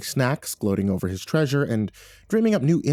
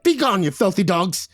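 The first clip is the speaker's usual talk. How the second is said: high-pitched leprechaun yelling; furious yell; 9 semitones higher than usual. A narrator's reading. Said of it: "He turned to the crew and yelled".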